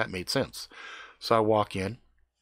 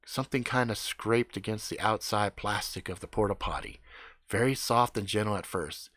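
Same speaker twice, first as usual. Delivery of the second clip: ordinary delivery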